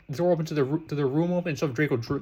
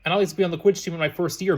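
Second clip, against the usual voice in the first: ordinary delivery, nothing marked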